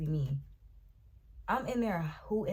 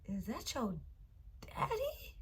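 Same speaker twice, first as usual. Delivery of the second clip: scared hushed question; 5 semitones higher, 7 dB quieter, slower than usual. Retold or imitated. Frightened hushed question to boyfriend.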